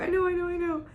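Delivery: high, mock-tearful agreement; high, pleading repeated reassurance; retold flustered sympathy. Acted tearful exchange with client.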